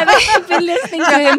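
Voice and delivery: real laughter breaking through the words; laughing while talking. Real, not acted. Guest laughing as she tells the host about [name]. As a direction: giggle through the line.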